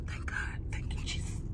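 breathy whispered thanks; whispered prayer. Real, not acted. Relieved woman whispers thanks to God. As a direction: whisper your gratitude upward.

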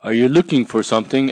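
a quick curious question; Ladybird asking. Acted.

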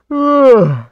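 a sudden loud pained groan; loud exaggerated groan. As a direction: groan out loud like a tired kid.

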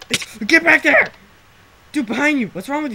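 high, loud frustrated shout; frustrated yelling. Real, not acted. Player sending friend back for items, frustrated yell. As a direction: yell directions at someone not listening.

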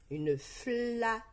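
"flaque" slowly split, long "fla" then "que"; découpage en syllabes. Shown as a demonstration. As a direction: stretch and detach both syllables of "flaque". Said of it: "Dis la première syllabe de chaque mot". ("flaque" découpé lentement, avec le "que" final prononcé)

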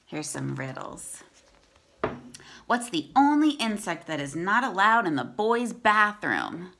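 clear, steady read-aloud voice; storybook reading for kids; reading to children. Acted.

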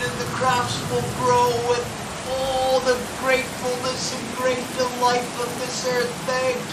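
very high, distant calling to the heavens; jubilant peasant. Acted. Grateful peasant thanking Raiden for the rain, high distant cries. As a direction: cry thanks upward from far off, stretched out.

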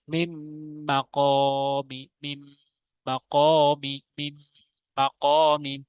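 "মিম মাকামি" with a long hummed m between the words; slow reciting, hold the merged m before "মাকামি"; ghunna recitation. Shown as a demonstration. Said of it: "গুণনা করে পড়া".

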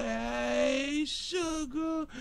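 high, drawn-out singsong hello; stretched, high-pitched sugary hail; sweet greeting. Real, not acted. Sweetly greeting a viewer in chat.

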